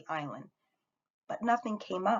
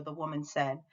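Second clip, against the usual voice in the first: ordinary delivery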